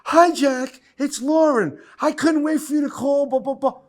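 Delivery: put-on high, sweet young woman on a voicemail; girly answering-machine voice. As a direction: play her breathless message in a high girly voice. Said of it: "The recorder, beep, beep".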